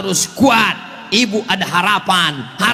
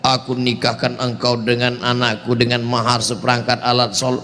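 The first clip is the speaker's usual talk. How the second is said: solemn wedding officiant; 8 semitones lower, 3 dB quieter than usual. Acted. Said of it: "Mau akad nikah".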